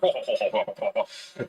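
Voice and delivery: low, loud bluster of ho-ho noises; mock blustering chuckle. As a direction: burble in a deep mock politician voice. Continues the Boris Johnson impression.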